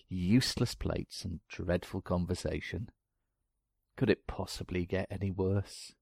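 ordinary talk

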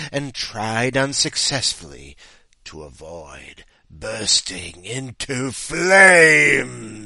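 very slow, building from hushed to loud; dramatic story narration. A narrator's reading. Host reading contestant two's fiery end, building dramatically. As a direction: stretch it out toward an overblown climax. Story climax read aloud.

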